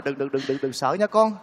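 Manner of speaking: low, soft reassurance; calm a scared child, voice dropped and hushed; soothing a child. Acted. Man comforting the frightened child, soft and soothing.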